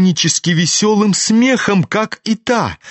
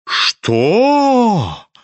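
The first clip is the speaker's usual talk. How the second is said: higher, stretched in horror and disbelief; aghast drawn-out what; 5 semitones higher than usual. A narrator's reading. Лизавета Прокофьевна в ужасе: протяжно, выше. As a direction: drag it out, scandalised. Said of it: "в удивлении, в негодовании, в ужасе протянула".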